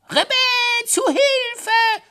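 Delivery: very high, loud squealed cry for help; shrieking scared hare. A narrator's reading. Scared hare crying to the household for help, shrieking high. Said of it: "schrie er".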